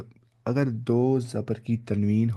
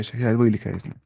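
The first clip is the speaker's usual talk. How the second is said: ordinary talk, nothing marked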